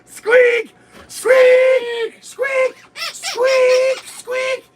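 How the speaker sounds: very high shouted squeals, fading; squeaky horn voice. Pretend. Host joining his co-host's squeak chant, very high shrieks.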